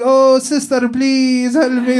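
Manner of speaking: high, loud, drawn-out begging; hammed-up plea for help; desperate pleading. Applicant begging the immigration agent, hammed-up and loud.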